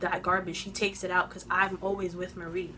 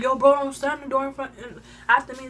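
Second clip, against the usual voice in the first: loud, raised-pitch retold protest; worked-up retelling of an argument; heated confrontation; 5 semitones higher, 7 dB louder than usual. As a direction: call her out, voice up. Retold yelling in hallway.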